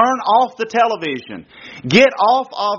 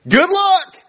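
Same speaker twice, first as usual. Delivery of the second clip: mock cheerful farewell; 11 semitones higher than usual. Father's mock farewell to daughter.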